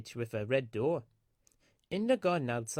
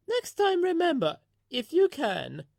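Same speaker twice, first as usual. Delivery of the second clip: much higher, louder character voice, knowing; wise old lorry; 14 semitones higher, 6 dB louder than usual. A narrator's reading. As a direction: tease her friend gently with wisdom. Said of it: "Elizabeth wisely told".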